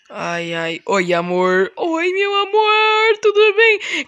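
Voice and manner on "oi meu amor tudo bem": high, sweet wife voice; lightly sung-out high wife voice. Acted. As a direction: greet him as the doting wife. Plays wife answering husband.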